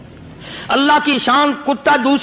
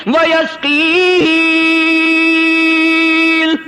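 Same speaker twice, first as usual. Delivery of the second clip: higher, slow emphatic pulpit voice, pounding each phrase; impassioned preaching; 4 semitones higher, slower than usual. Acted. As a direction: lift the crowd, lean hard on every اس. Impassioned sermon build, slow emphatic. (پرجوش خطیب کی طرح بلند آواز میں)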